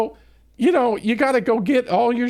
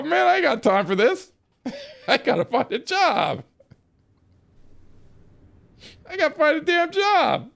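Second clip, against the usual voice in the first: high, laughing through the words; laughing retort; 9 semitones higher than usual. Retold or imitated. Telling the base commander he's done, laughing high. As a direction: crack up while you brush him off.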